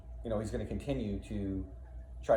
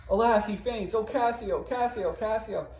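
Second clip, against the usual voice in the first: high, loud anguished wail over the fainting man; Bianca's high, frantic grief; distraught cry; 12 semitones higher, 6 dB louder than usual. Acted. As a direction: cry his name in panic, rising and loud. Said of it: "Bianca is upset".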